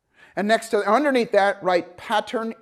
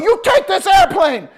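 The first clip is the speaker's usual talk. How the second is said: loud, high, slow panicked demand; panicked student pilot begging instructor to take control; desperate yell; 10 semitones higher, 7 dB louder than usual. Retold or imitated. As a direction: scream it like you're about to crash.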